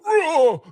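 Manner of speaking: a big roaring death cry, loud and high; crushed father's roaring death cry. Retold or imitated. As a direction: bellow it like a man being crushed. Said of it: "like this death cry".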